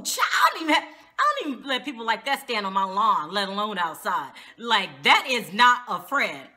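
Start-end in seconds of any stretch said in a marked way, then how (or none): none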